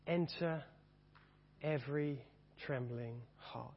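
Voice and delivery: slow, reverent verse reading; hymn reading. Acted. Preacher reading the Wesley hymn to the church, slow and solemn. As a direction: read each line slowly and reverently.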